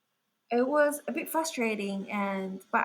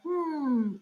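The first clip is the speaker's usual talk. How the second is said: higher-pitched musing hum; pondering; 6 semitones higher than usual. Acted. Describing the painted faces, acted pondering hum. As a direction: act out a pondering face. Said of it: "it's pondering faces".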